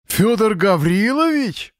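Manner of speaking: high, drawn-out disbelieving question; astonished. A narrator's reading. Razgulyaev seeing Fyodor appear unexpectedly, astonished. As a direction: stretch the name out in amazement. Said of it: "Разгуляев даже присвистнул".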